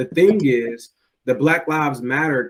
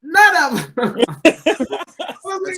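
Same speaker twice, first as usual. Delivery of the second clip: laughing; 13 semitones higher, 5 dB louder, much slower than usual. Real, not acted. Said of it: "laugh on line".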